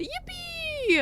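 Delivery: high squeaky yippee; yippee cheer. Real, not acted. Cheering at a good day.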